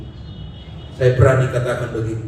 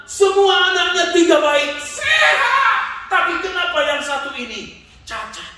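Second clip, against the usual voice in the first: anguished prayer; 12 semitones higher, 4 dB louder than usual. Retold or imitated. Preacher voices grieving man's prayer.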